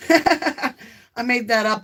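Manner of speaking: slow words through laughter; laughing. Real, not acted. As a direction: laugh while you admit it. Laughing at her own joke.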